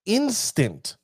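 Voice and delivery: "instant" set apart, pitched high then falling; single out "instant" with a high, deliberate stress; pointed emphasis. Shown as a demonstration. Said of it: "notice how I'm saying that".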